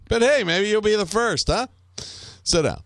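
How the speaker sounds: high mock-friendly menace; taunting interrogator. Plays interrogator taunting a prisoner.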